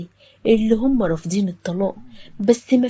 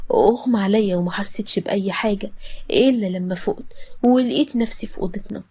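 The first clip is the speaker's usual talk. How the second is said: usual speaking voice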